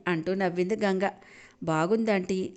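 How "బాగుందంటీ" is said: usual speaking voice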